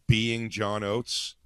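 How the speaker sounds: drawn-out, deadpan; slow dry delivery; slow deadpan punchline. Retold or imitated. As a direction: land the joke slowly. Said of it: "And I went".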